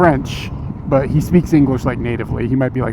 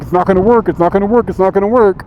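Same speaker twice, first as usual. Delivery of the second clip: singsong mock chat chant, pitched up; 5 semitones higher, 4 dB louder than usual. Chat doubting the narrator's fix, mocking chant. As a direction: parrot the doubters in a taunting repeat. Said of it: "everybody in chat was like".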